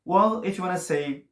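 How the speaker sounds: normal talking voice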